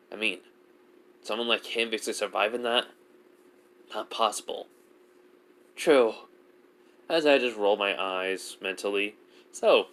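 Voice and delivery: plain reading voice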